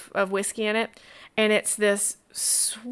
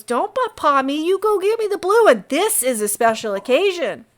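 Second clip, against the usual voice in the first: loud, higher fatherly scold; 7 semitones higher, 6 dB louder than usual. Acted. Innkeeper scolds his son Pip, loud and higher. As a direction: shut down the whining boy.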